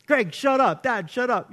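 annoyed shushing. Retold or imitated. Family to dad during scary movie, mock annoyance. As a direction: imitate fed-up family.

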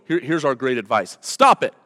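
mocking the stop-it advice; mock stern advice. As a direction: bark the order like a scold. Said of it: "we just go".

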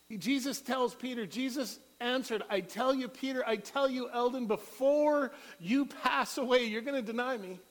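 high, loud preacher's voice; preaching at full force. Acted.

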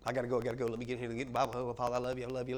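hurried, dropped-voice mock of a rushed believer; rushed prayer. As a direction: gabble a quick prayer on the way out the door. Mocking rushed quick prayer.

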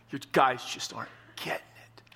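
a whispered aside. Real, not acted. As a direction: whisper it like a confided secret. Hushed aside about the disciples.

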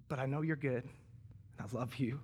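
soft, slowing, low confession of love; hushed retold prayer. Father recalling his prayer to God in the hospital, hushed. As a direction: drop to a tender near-murmur, retelling a prayer.

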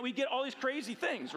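ordinary talk, nothing marked

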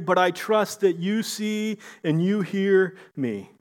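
fervent prayer. Acted. Job telling God he trusts him, fervent prayer. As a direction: pray it up with growing confidence.

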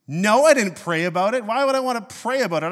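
loud, high comic indignation; indignant retort. Retold or imitated. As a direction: snap back, hammed-up, at a silly question. Acted indignant answer to ridiculous question.

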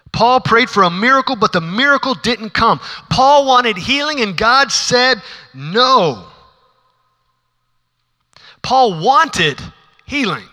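dramatic sermon climax; emphatic preaching. Acted. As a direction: drag out "and God said no" for weight.

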